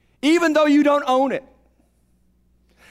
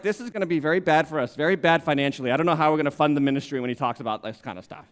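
lower, grumbling money worries; worried Judas; 7 semitones lower, 3 dB quieter, faster than usual. Retold or imitated. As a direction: fret about the budget under your breath. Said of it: "Judas is like".

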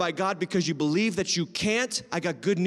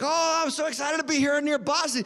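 mock-eager friend, pitched higher; excited visitor; 7 semitones higher than usual. Visiting friend to host about seeing Boston, imitated excitement. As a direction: imitate an eager tourist in a high voice. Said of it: "he's like".

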